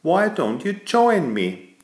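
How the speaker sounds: high, slow, sweet little mole lady; a mole offers worms and bugs, high and slow. Acted. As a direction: keep the small high-pitched mole voice going. Mole's quoted invitation continues.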